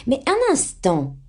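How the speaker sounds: high, drawn-out impetuous exclamation; sudden cry. A narrator's reading. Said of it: "s'écria soudain l'impétueuse Jo". (s'écrier soudain, avec impétuosité)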